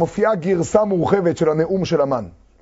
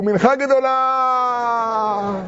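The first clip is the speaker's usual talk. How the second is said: high, long-held holler; sustained call to warn everyone; shouted warning call; 5 semitones higher, much slower than usual. Acted. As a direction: stretch the last word out like a street cry. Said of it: "אז צעקו".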